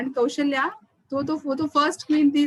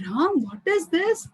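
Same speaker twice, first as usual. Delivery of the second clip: higher, slow, stunned question; shocked disbelief; 5 semitones higher, slower than usual. Acted. Mother Kaushalya questions Ram on hearing the news, shocked. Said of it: "Kaushalya was shocked to know".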